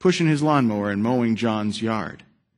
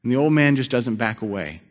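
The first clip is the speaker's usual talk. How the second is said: normal talking voice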